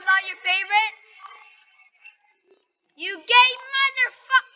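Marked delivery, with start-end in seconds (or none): 3.0-4.5 high, loud, slow put-on taunt voice; hammed-up catchphrase yell at a teammate; mock trash-talk cry. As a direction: stretch the insult out like a cartoon villain. Repeats his catchphrase taunt.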